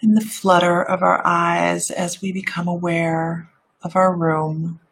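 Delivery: meditative voice. Shown as a demonstration. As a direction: ease them gently back to the room. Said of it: "let me get out of my meditative voice".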